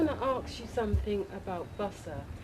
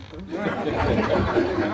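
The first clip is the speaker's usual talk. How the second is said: a burst of real laughter, louder; 9 dB louder than usual. Real, not acted. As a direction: laugh out loud, amused. Student laughs after soft-voice joke.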